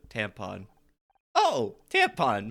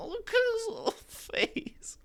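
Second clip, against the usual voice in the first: much higher, slow cooing voice; slower than usual. Real, not acted. Cooing over the dog Scout.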